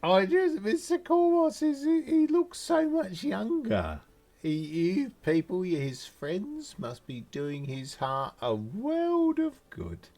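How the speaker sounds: high-pitched doting praise; gushing gnome woman. Acted. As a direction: repeat the compliment in a high, fawning voice.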